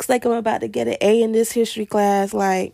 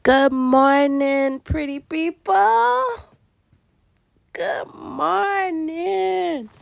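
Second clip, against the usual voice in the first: very slow, high morning call; drawn-out lilting wake-up hello to listeners; sing-song greeting; 5 semitones higher, much slower than usual. Real, not acted. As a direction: stretch the words out long, pitch swooping up high.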